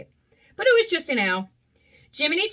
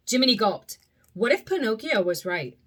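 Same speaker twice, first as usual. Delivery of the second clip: usual speaking voice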